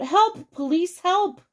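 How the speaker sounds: high cry of help; calling out for help. A narrator's reading. Bystander crying out to passersby as robbers flee, calling out. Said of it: "somebody called out".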